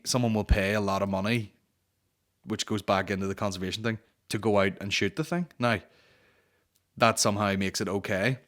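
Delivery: ordinary talk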